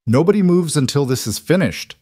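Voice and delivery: high, forceful order; commanding. A narrator's reading. James to the shouting men, commanding. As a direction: command a crowd to freeze. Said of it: "something in his tone made everyone freeze".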